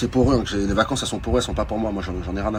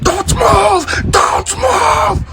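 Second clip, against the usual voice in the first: shouted police command; mock police shout; much slower than usual. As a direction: yell it like a cop storming in. Police storming the restaurant.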